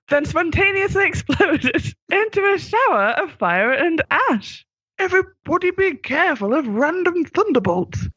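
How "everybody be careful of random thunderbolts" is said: a high, breezy Fifth Doctor; Fifth Doctor advising. Acted. Fifth Doctor warning the others after the explosion. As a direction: offer it too late, lightly. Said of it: "advised the Fifth Doctor belatedly".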